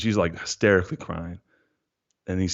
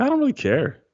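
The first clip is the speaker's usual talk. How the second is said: mock indifference. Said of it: "But I was like".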